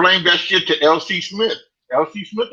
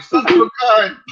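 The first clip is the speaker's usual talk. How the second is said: retold shock; 9 semitones higher than usual. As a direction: blurt it out in disbelief. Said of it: "I'm like".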